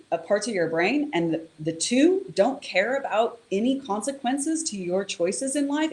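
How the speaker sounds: ordinary talk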